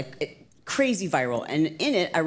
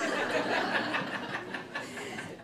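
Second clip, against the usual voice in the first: high, soft real laugh; 12 semitones higher, 8 dB quieter than usual. Real, not acted. Speaker admitting love of gluing rhinestones, amused laughter. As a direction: laugh at your own silly hobby. Laughs about own crafting hobby.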